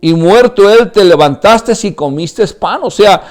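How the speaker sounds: high, loud, impassioned sermon; preaching at full force. Acted. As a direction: pour it all out to the congregation. (predicación a todo pulmón, aguda y fuerte)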